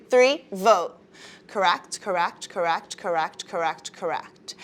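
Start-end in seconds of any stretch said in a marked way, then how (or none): 1.5-4.6 each correct softer, fading to a murmur; soft murmured praise. Real, not acted. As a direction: let the praise fade away. Teacher softly confirming the class.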